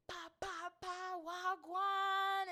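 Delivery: high, slow, drawn-out Jamaican patois greeting; hammed-up Jamaican patois hail; mock Jamaican patois. Imitating unruly Jamaicans in a Jamaican accent.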